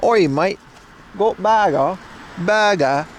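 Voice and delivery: put-on Aussie-British accent; British-tinged accent. Streamer mimics viewers' speech, mock British-Australian accent. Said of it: "That's a bit British".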